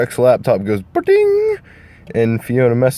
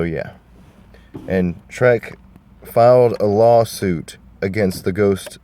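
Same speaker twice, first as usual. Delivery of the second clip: normal talking voice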